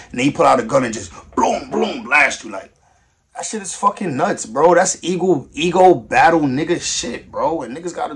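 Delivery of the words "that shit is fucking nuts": ordinary delivery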